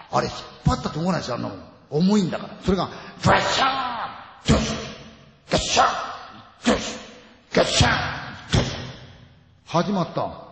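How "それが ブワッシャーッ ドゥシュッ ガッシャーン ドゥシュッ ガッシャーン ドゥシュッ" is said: bang out the crashing rig; crash sound effects. Retold or imitated. Imitating falling lighting rig crashes.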